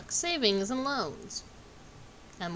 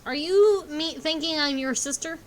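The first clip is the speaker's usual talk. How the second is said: mock texting voice; 7 semitones higher, 8 dB louder than usual. Reading out a text in character.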